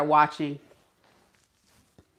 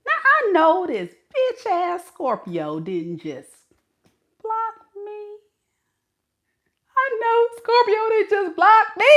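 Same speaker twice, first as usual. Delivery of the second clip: high, shrill indignant disbelief; hammed-up outraged squeal; mock outrage. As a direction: act shocked at being blocked. Ex outraged at being blocked.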